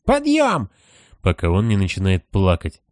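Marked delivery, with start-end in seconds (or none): 0.0-0.7 squealing high-pitched command; shrill shouting voices. Acted. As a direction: shriek the wake-up call. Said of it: "визгливые голоса всё выкрикивают".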